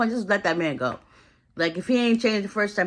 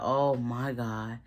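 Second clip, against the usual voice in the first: low, soft, slow imitation of dismay; hushed dread; 7 semitones lower, 7 dB quieter, much slower than usual. Retelling her seeing the phone, hushed dismay. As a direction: let it sink out low and drawn out. Sees who is at the door.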